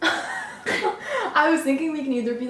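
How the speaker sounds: laughing through the words; giggling as she talks. Real, not acted. Laughs at failed video.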